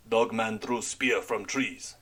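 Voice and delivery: put-on slow, broken English in a heavy foreign accent; halting foreign accent, searching for words; frightened man in a heavy accent. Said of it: "in his heavy accent".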